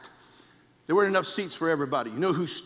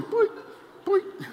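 boing noise. Retold or imitated. Imitating bird's head springing.

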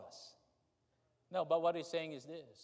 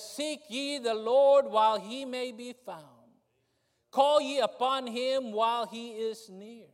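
preaching scripture; 7 semitones higher, 8 dB louder than usual. Acted.

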